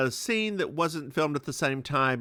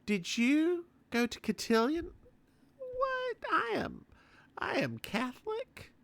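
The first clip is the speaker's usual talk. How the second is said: mock high indignant; 9 semitones higher, 4 dB quieter than usual. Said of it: "She also tried to".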